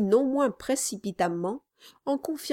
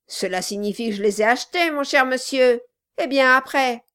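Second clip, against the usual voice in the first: sneering dwarf voice; 5 semitones higher, 5 dB louder than usual. Acted. Dwarf mocking the old man.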